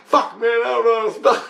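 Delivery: high-pitched mock exasperation through laughter; laughing retelling. Retelling his baffled answer about spelling, high and laughing. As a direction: imitate a flustered answer, cracking up.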